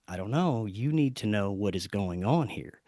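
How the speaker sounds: low, soft imitation of an unhelpful reply; hushed and ominous retold answer; cryptic friend. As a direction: quote him quietly, down in pitch, withholding. Changed friend gives a cryptic answer.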